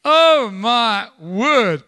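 loud, drawn-out exclamation; slow, emphatic pulpit dismay; dramatic dismay. Real, not acted. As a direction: stretch every word in shock. Dramatic exclamation over grim events.

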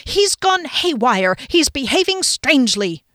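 high, loud, emphatic alarm; agitated outburst. A narrator's reading. Friend slapping the desk, agitated outburst. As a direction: burst out in agitation, hitting key words. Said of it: "slapped his hands down on the desk".

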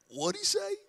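put-on high little kid's whisper-question; high, hushed kid voice; puzzled child. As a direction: play the confused boy asking mom. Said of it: "I look at my mom and go".

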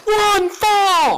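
high shouted echo; crowd yell. Pretend. Echoing the announcer.

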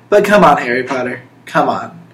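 ordinary talk

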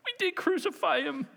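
high-pitched distressed plea; acted anguished plea. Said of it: "they said to Peter and the rest".

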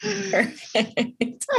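laughing hard. Real, not acted. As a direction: breaks into laughter. Both laughing after a joke.

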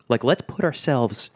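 ordinary talk, nothing marked

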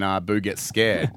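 usual speaking voice

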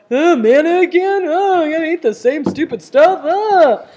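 loud, high whining groan; grumbly and miserable. Acted. Earthly minded people facing manna again, miserable grumbling. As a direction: complain with a weary, whiny groan. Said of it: "they're grumbly and miserable".

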